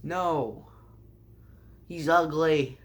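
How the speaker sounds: usual speaking voice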